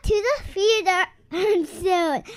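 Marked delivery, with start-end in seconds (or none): none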